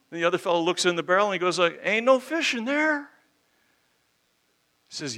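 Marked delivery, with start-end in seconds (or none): 1.8-3.1 cartoon passerby. Passerby mocks the barrel.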